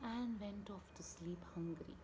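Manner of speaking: unvoiced sleepy whisper; soft whispered narration. A narrator's reading. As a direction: hush as he drifts off.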